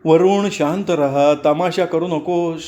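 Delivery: slow, drawn-out plea for calm; lightly voiced father reining in his son; admonishing father. A narrator's reading. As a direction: stretch it out, urging calm.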